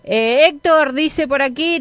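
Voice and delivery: usual speaking voice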